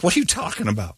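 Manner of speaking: incredulous. Retold or imitated. His own retold reply.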